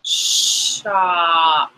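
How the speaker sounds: "Shop" drawn out slowly. Shown as a demonstration. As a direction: stretch "Shop" for the child. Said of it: "Let's say it slowly".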